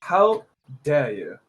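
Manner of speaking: mock posh British outrage. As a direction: sound affronted like a posh Brit. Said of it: "British accent".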